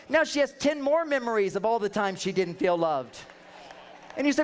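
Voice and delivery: normal talking voice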